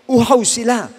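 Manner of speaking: ordinary talk, nothing marked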